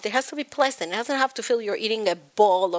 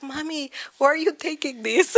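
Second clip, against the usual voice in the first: put-on quoting kids; 6 semitones higher than usual. Kids' voice in retold scene.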